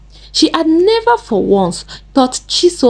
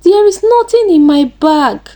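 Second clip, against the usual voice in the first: high-pitched, anxious protest; panicked denial; 9 semitones higher, 4 dB louder than usual. A narrator's reading. Cornered Chisom denies hiding anything, panicked. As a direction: deny it in a raised, frightened pitch. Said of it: "She started shaking uncontrollably".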